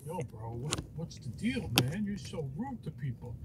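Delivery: low, soft, laid-back bro voice; deep, quiet pretend dude; Goku. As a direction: drop way down for a chill toy-character voice. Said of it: "Get out of here, Goku".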